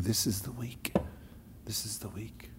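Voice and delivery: soft, fading to a whisper; gentle voice of God. Acted. God gently calling the unbeliever, whispered. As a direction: call softly, then barely breathe it. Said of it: "just gently, consistently saying".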